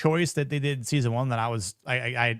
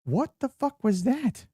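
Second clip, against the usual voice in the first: rising, higher and louder disbelief; baffled outburst; 10 semitones higher, 4 dB louder, slower than usual. Real, not acted. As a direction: react like you can't believe it. Reacting to strange show moment.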